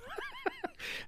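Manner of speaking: high, light laughter; real laugh. Real, not acted. Amused by his own story, laughing. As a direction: break into a helpless little laugh. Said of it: "Don't know why I'm laughing".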